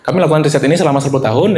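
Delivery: fast and careless, sepuluh tahun thrown away; rushed, no emphasis. Shown as a demonstration. Teacher showing the wrong fast way to state a research claim, fast. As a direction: rattle "selama sepuluh tahun" off with no stress.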